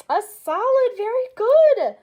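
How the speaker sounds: high, loud, beaming praise; enthusiastic praise. Acted. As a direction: cheer like a kids show host. Praising pretend answers from kids.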